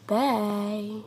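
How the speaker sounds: ordinary talk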